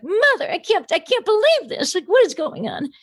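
high-pitched, theatrical movie-star impression; hammed-up high diva voice. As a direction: mimic a dramatic diva in disbelief, way up high. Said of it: "just mimic her from Arthur".

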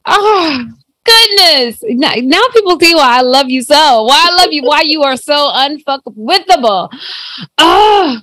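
choked up with emotion, pitch jumping up; tearful. Real, not acted. Host moved by guest's words.

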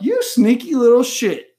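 high, loud, teasing reproach; mock accusation. Host retelling catching a partner's secret, in mock outrage. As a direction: accuse them playfully, pitched right up.

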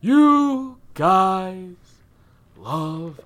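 high, loud, drawn-out words; mock-epic announcer. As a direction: stretch each word like a big reveal. Drawn-out announcer bit, then gives up.

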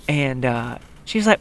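normal talking voice

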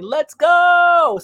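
loud, high hype call; hype shout. Retold or imitated. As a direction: shout it like a rally cry. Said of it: "that's my favorite saying".

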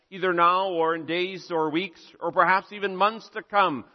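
ordinary talk, nothing marked

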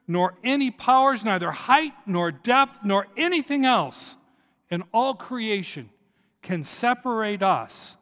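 proclaiming the scripture with mounting force; preaching. Acted. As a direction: preach the list, rising to a peak. Reads Paul's letter, building.

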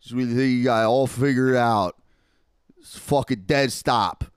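imitated frontman, loud and strained; singer's stage intro. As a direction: announce the song over a loud crowd. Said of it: "he intros it".

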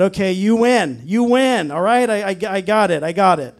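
loud, high, exasperated surrender; giving in. Retold or imitated. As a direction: throw up your hands and concede loudly. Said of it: "it was so strong that I said".

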